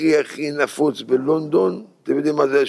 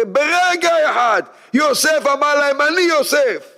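high ringing proclamation, much higher; climactic shout; 11 semitones higher than usual. Acted. Joseph revealing himself to his brothers, ringing shout. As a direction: cry it out at the peak.

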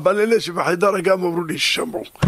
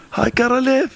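mocking echo; 8 semitones higher than usual. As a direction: sneer the doctor's words back. Preacher mockingly repeats doctor's phrase.

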